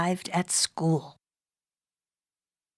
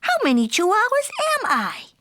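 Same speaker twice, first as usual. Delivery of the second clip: high, wide-eyed kitten voice; wondering out loud; 13 semitones higher than usual. A narrator's reading. Kitten counting himself in the mirror, high and amazed. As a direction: wonder aloud in an amazed little voice. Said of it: "he wondered out loud".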